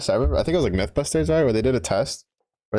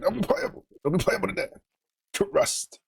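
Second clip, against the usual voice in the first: high squawky goose-like bird voice; honking voice; 12 semitones higher, 4 dB quieter than usual. Retold or imitated. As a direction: honk it out like a whiny goose. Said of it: "They would be like".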